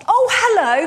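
high, loud eager hello; hammed-up enthusiastic greeting; overly enthusiastic. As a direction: pretend you know exactly who they are. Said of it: "I always just respond".

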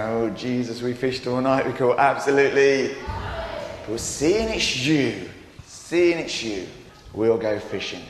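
a hint of a reluctant fisherman giving in; weary Simon. Simon answering Jesus after a fruitless night, wearily. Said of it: "I can imagine Simon going".

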